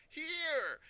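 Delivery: very high, very loud answer; full shout. A narrator's reading. Invisible boy to his baffled daddy, shouting. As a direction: shout it to be found at last.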